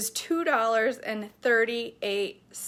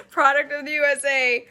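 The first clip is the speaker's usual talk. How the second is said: loud, raised, steadier proclamation; loud redo; 4 semitones higher, 8 dB louder than usual. Real, not acted. Vlogger rereading the label after a squeak, loud amused proclamation. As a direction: proclaim it loudly, amused at yourself. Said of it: "my voice just squeaked".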